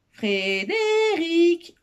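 very slow, high, drawn-out yell; long high-pitched summoning call; hollering. A narrator's reading. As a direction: holler the name, stretching each syllable. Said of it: "Il eut beau hurler".

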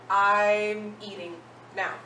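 I'm eating now, said slowly and clearly word by word; slow model phrase. Shown as a demonstration. Teacher modelling the phrase for students, slow and deliberate. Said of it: "So if I wanna say".

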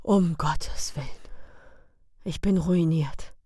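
whispered despairing groan; hushed despairing whisper. A narrator's reading. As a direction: moan it as ruin sinks in. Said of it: "Er stöhnte".

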